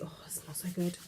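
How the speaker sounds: hushed whispered delight; sniffing and whispering with pleasure. Real, not acted. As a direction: breathe it out in a whisper.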